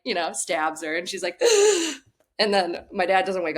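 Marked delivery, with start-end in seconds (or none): 1.4-2.0 sudden high gasp for air; sharp gasp. Retold or imitated. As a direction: gasp back to life after the shot. Mom stabbed with EpiPen, gasps.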